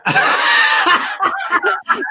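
big real belly laugh; loud laughter. Real, not acted. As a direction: burst out laughing. Caller's wheelchair joke.

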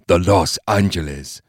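put-on old man's accented character voice; measured old-man voice, foreign-accented; Bo Pan's voice. A narrator's reading. Bo Pan naming the sub to Steve, accented old-man voice.

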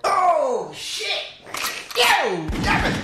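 high, whooping disbelief; shocked outburst. Real, not acted. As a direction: react to a savage burn. Host reacts to savage remark.

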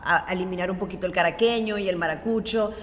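normal talking voice

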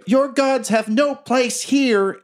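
put-on high, slow, ominous child voice; murderous child. Murder child dispelling magic across the arena, ominous child voice. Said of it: "you hear a murder child say".

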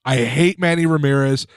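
usual speaking voice

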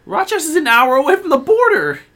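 high, loud indignant complaint; exasperated outburst. Retold or imitated. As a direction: rant in high disbelief. Mock outrage at venue.

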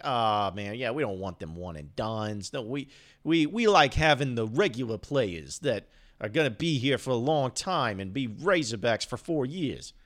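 hammed-up Italian Jersey accent. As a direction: put on an Italian-American Jersey accent for grumbling fans. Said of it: "I just wanted to do an Italian Jersey accent".

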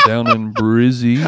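slow mock Australian accent. Practising for the Australia trip, slow mock Aussie. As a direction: stretch it out in a low Aussie voice. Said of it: "as I will go lower".

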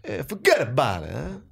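put-on comic Italian-American accent; movie Italian-American accent. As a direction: play the Italian tough from the movies. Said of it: "there's an Italian guy".

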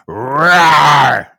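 a small creature trying to sound fierce; tiny squeaky trying to roar. Pretend. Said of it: "I'm gonna do the growl".

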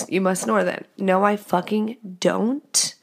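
usual speaking voice

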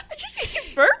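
very high, helpless giggly confession; barely getting words out for laughing. Real, not acted. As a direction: squeal it through real laughter. Cracking up over own burps.

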